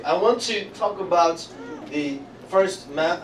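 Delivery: ordinary delivery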